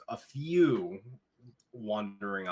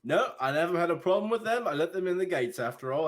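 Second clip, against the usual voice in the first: much higher, loud, demanding questions; an officious young guard scolding; indignant guard; 9 semitones higher, 3 dB louder than usual. Acted. Guard confronting the party, high and indignant.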